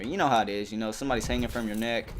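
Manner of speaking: ordinary talk, nothing marked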